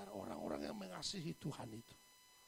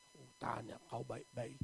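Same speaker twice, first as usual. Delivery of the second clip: ordinary delivery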